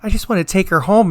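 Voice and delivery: high, gushing day-shift nurse; gushing coworker. Retold or imitated. Nurse imitating day shift gushing about the patient. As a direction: gush over the sweet patient. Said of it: "day shift's like".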